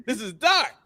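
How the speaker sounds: laughing quote. Retold or imitated. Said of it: "which I was like".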